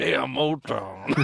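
very drawn-out robot villain impression; Ultron. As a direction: stretch the name out in a put-on villain voice. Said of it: "that's what he sounds like".

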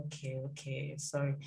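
low, soft, cursory soothing; placating parent. Retold or imitated. Parent to a crying child, quietly placating. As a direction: briefly hush the child, imitating a parent. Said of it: "then they're like".

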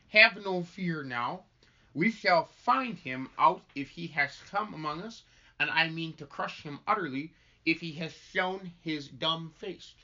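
slow, weighty stage delivery; solemn minister. Acted. Minister vows to crush the devil, solemn. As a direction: pronounce it with deliberate emphasis. Script read in character, chat between.

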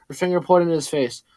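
ordinary delivery, nothing marked

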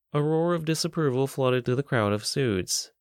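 ordinary delivery

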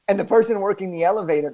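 usual speaking voice

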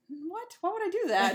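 high-pitched mock disbelief; baffled denial. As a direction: act out an incredulous protest. Retells bewildered denial of blame.

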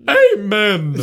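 joyous mock shout; exclaimed. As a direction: shout it like church. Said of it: "exclaimed Tom".

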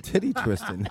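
high, silly squeal; squeaky joke voice. Acted. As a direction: squeak it out as a gag.

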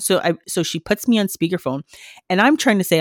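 ordinary talk, nothing marked

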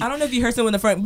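ordinary talk, nothing marked

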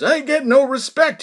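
high, loud put-on comic whine of a standup; Rodney Dangerfield impression. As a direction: mimic an old man doing a famous comedian. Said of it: "doing this Rodney impression".

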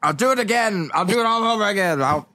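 high-pitched mock tantrum; hammed-up outburst of rebellion; defiant son. Son defies father in acted quarrel.

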